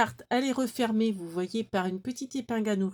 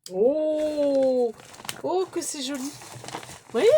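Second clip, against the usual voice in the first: higher, excited gasp of delight; 5 semitones higher, 4 dB louder, much slower than usual. Real, not acted. Vlogger delighted by the pretty card, raised and bright. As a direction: marvel at it out loud.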